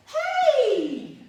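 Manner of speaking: loud, high, drawn-out hail; big whooped hello; excited greeting. Retold or imitated. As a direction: call out joyfully across the terminal. Calls out greeting at airport.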